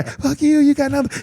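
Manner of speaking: high laughing taunt from behind a big man; mock taunt. Teen taunting the gang from safety, laughing high. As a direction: jeer like a cocky kid now protected.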